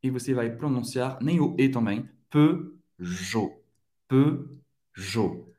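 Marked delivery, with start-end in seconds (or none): 2.3-5.5 "Peugeot" as separated pø-JO, no T; silent T. Shown as a demonstration. Teacher modelling the car brand, silent T. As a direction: end "Peugeot" on a clean O, T silent. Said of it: "não vai pronunciar o T".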